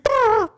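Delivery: high, loud blaring lip buzz; loud trumpet blare. Retold or imitated. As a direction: blast it like a honking horn.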